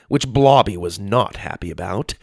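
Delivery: plain reading voice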